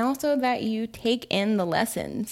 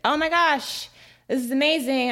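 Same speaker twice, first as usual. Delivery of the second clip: put-on excited kid; 7 semitones higher than usual. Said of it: "he got really excited".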